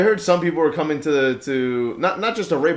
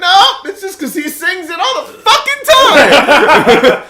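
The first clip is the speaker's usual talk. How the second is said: laughing; 11 semitones higher, 4 dB louder, much slower than usual. Retold or imitated. Laughing retelling reply.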